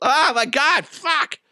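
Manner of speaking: high-pitched real exasperation; exasperated. Real, not acted. Host beset by hiccups, high exasperated cursing.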